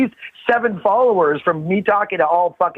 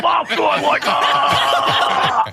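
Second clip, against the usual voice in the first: put-on high, slow Scottish accent, shouting; thick Scottish accent, building to a yell; 9 semitones higher, much slower than usual. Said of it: "with that thick Scottish accent".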